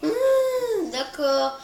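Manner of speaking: whiny, worn-out moan; exhausted whimper. Shown as a demonstration. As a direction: groan it out like you're dead tired. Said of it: "je parlais un peu comme ça".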